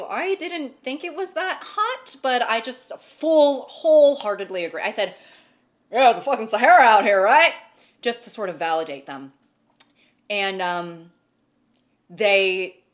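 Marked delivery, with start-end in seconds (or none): 5.9-7.5 emphatic loud agreement. Retold or imitated. Agreeing loudly with the barista.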